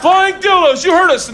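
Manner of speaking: booing heckle. Retold or imitated. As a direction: heckle her off like a rowdy mob. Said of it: "we boo her off stage".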